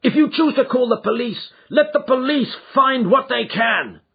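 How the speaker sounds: high, loud challenge; defiant anger. Acted. Son challenging his father to call the police. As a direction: dare him to call the police.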